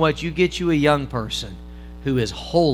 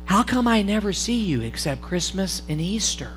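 hushed, softer aside to the churchgoer; whispered; 2 semitones higher, 7 dB quieter than usual. Retold or imitated. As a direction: say it quietly so no one overhears. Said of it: "He kinda whispered and he said".

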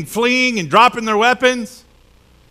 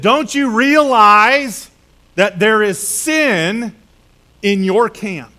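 very slow, weighty question; slow emphatic; 4 dB louder, much slower than usual. Retold or imitated. God confronting Joshua about sin, slow weighty question. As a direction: drag each word out for weight.

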